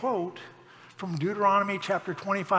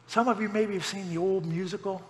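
ordinary delivery

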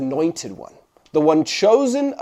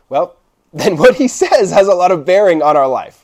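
ordinary talk, nothing marked

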